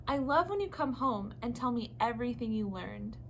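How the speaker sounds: ordinary delivery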